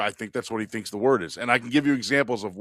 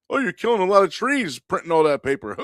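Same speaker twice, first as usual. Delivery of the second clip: playful teasing quip, raised pitch; jokingly; 10 semitones higher, 5 dB louder than usual. Retold or imitated. Joking coworker teases about printing, playful raised pitch. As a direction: tease him lightly, a bit higher. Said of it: "jokingly said".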